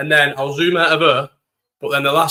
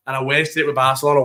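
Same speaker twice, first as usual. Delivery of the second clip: ordinary talk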